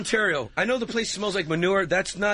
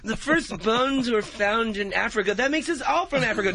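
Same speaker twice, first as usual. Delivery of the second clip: put-on blonde girl; 5 semitones higher than usual. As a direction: preach it like an over-earnest young woman.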